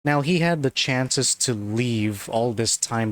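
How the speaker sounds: normal talking voice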